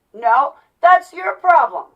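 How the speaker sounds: loud, high, slow and emphatic comeback; mock angry retort. Vlogger acting out a quarrel with critics, loudly indignant. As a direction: throw it back, stressing each word slowly.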